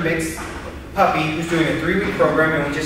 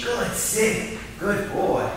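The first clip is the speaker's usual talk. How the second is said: whispered praise; 5 semitones higher, 6 dB quieter, much slower than usual. Real, not acted. Quiet praise to dog.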